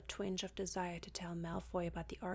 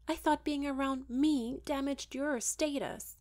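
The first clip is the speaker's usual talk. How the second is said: louder, raised bitter accusation; scowling; 6 semitones higher, 7 dB louder than usual. Acted. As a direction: fling the old insult back at him. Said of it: "she scowled".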